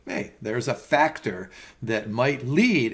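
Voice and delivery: ordinary delivery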